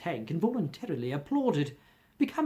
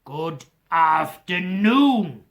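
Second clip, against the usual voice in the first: slow, louder final goodbye; drawn-out dismissal; 8 dB louder, much slower than usual. Acted. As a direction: drag it out to end the talk. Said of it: "said Scrooge".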